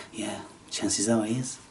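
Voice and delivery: ordinary delivery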